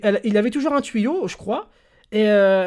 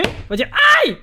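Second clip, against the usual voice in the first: mock ouch; 10 semitones higher, 4 dB louder than usual. Shown as a demonstration. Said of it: "Toi, tu vas te taper".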